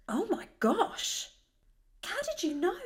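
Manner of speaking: hushed, high mock wonder; breathy sarcastic awe; mock amazement. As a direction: feign being stunned by the note.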